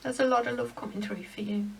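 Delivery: quiet, emotion catching in the throat; choked up. Real, not acted. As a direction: hold back tears as the words come. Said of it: "I got choked up then".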